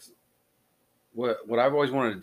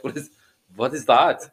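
loud mock-German villain; hammed-up German-accented villain question; villainish German accent; 3 semitones higher, 7 dB louder, slower than usual. Imagined Germans baffled by the podcast, loud mock German accent. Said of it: "like, some villainish type thing".

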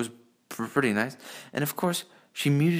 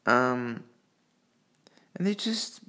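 ordinary talk

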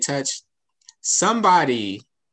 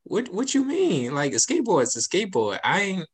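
high-pitched retort, retold; mock-baffled protest, pitched up; taken aback; 7 semitones higher than usual. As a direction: act out a puzzled, defensive comeback. Retells challenging a dismissive remark.

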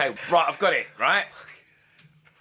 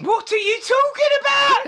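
high, loud disbelieving squeal; a shrill mock-shocked what are you talking about; incredulous; 5 dB louder than usual. Real, not acted. Mock outraged repeat of question.

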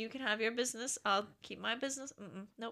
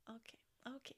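soft, voiceless nervous okay; whispered okay, mock nervous; 21 dB quieter, faster than usual. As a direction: breathe it out, scared to speak. Nervous quote in retold scene.

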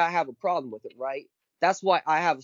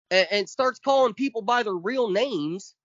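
ordinary delivery

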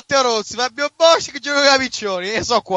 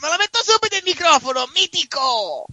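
Grande Fratello voice; 7 semitones higher than usual. Retold or imitated. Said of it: "Forti che fa praticamente la voce del Grande Fratello".

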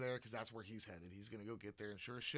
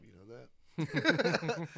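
drawn-out quip; 10 dB louder, much slower than usual. Retold or imitated. Laurel's dry remark retold.

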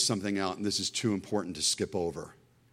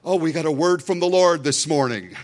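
higher and louder, a pulpit announcement; mock pastor announcement; 7 semitones higher, 9 dB louder than usual. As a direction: play the pastor proclaiming it brightly from the pulpit. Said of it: "can you imagine?".

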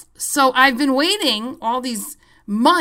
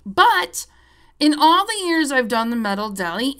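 normal talking voice

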